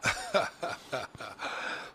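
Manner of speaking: breathy, airless smooth chuckle; a silky lounge-host laugh. Acted. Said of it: "you gotta do the smooth laugh".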